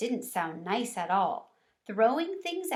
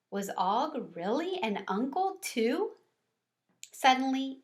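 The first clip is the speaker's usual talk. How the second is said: plain reading voice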